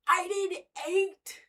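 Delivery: drawn out, stressing I; slow proud claim. Real, not acted. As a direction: savour the claim slowly. Says it slowly, then whispers.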